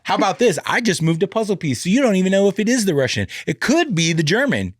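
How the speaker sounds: put-on loud, high, cocky retort to a kid; mock bragging comeback. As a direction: play the sore loser, puffed up and loud. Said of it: "I go".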